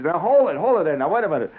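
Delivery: high mock doctor coaxing a kid; a doctor telling the boy to hold still, in a high mock voice. Kid's doctor, high and coaxing.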